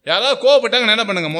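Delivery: normal talking voice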